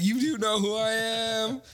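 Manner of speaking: high, drawn-out self-importance; mock indignant star. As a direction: imitate an affronted celebrity, stretching it out. Voicing an indignant famous rock star.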